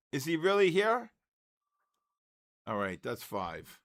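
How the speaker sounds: ordinary talk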